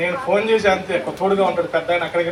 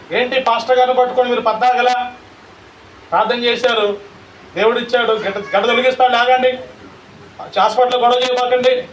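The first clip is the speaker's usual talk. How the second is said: raised, louder scolding voice; scolding nurse; 5 semitones higher, 5 dB louder than usual. Retold or imitated. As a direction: tell him off for praying. Nurse scolding pastor retold.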